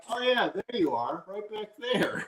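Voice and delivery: high, soft, drawn-out teasing voice; imitating girlfriend. Girlfriend pointing him out in pictures, high imitated voice. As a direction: mimic her spotting you, high and slow. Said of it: "And she's like".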